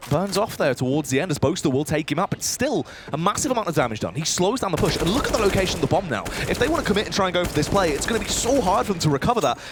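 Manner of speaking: lively play-by-play call; esports caster. Real, not acted. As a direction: call the round as it happens. Caster calls live round.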